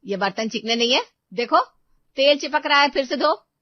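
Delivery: strict mistress berating the maid over greasy dishes; harsh scolding. Acted. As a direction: bark at the maid to rewash. Seema inspects dishes angrily.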